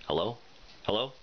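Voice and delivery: usual speaking voice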